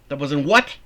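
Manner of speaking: loud, changed-voice retort; sharp challenge. Acted. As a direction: dare him to repeat it. Martha snaps back.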